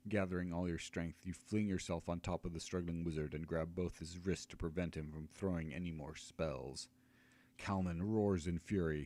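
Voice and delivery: usual speaking voice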